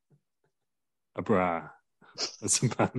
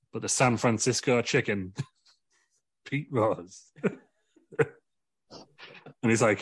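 ordinary talk

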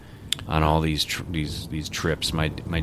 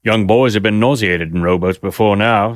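a lightly voiced stern gentleman, a bit raised; stern board member; 3 semitones higher, 8 dB louder than usual. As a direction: grumble about the trade. Another gentleman objects.

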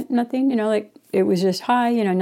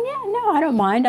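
"Yeah, no" jumps much higher, thrilled; half-joking eager yes to speeding; excited; 11 semitones higher than usual. Retold or imitated. Eager yes to the biker, voice pitched way up. Retold thought about going fast.

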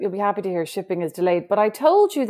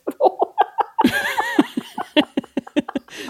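long, high-pitched burst of giggling; high laugh. Real, not acted. Friend laughs at her own dishwasher plan, high giggles. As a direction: break into squeaky helpless laughter.

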